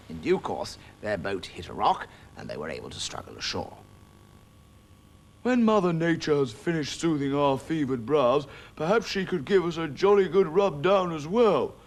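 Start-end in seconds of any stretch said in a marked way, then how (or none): 5.4-11.7 sour, sarcastic cat grumble; soaked cat sneering at the mice; bitter. Acted. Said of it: "said Samson bitterly".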